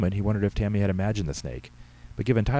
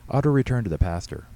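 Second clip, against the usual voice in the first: ordinary delivery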